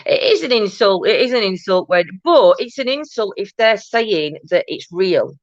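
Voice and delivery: ordinary talk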